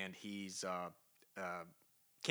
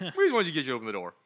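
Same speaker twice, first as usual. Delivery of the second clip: loud, fast sheepish admission; fast Groot excuse; 6 semitones higher, 9 dB louder, much faster than usual. Retold or imitated. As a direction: rattle off the excuse. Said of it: "Groot's like".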